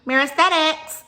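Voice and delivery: high, chirpy phone greeting, imitated; mimicked receptionist. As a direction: play the receptionist answering the phone. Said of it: "the girl was like".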